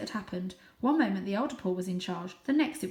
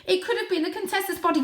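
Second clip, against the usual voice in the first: high, fast, blurted out; urgent kitten; 12 semitones higher, 7 dB louder, faster than usual. Acted. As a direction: rush it out before they stop you.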